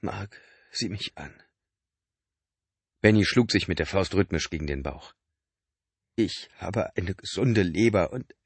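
a lightly voiced sick man, pressing his brother; Benny, weak and urgent. A narrator's reading. Benny pressing Mark about the liver, lightly voiced urgency.

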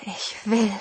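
second unvoiced whisper, repeated. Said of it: "flüsterte ich". (noch einmal geflüstert)

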